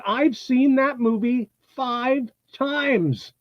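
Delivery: impressed fan stressing her devotion; eager fan. Retold or imitated. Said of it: "She said".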